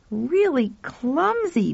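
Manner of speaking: put-on high, slow young boy; drawn out in wonder; boy's voice. Pal surveys the broken wall, slow high boy. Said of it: "Pal said".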